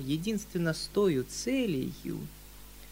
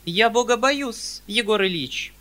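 with dignity; 6 semitones higher, 6 dB louder than usual. A narrator's reading. Perepelitsyna answers the uncle with prim dignity. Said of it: "с достоинством отвечала".

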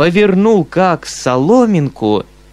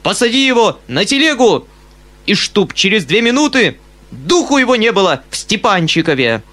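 high, loud commands; shouting order; 6 semitones higher, 4 dB louder than usual. A narrator's reading. Uncle orders Foma thrown out, shouting. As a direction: banish him at once. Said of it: "вскричал дядя".

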